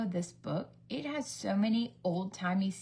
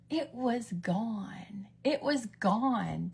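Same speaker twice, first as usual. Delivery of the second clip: very slow, hushed at first; drawn-out mock-dramatic disbelief; dramatic reveal; much slower than usual. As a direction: stretch each word for suspense. Dramatic reveal of the deleted post.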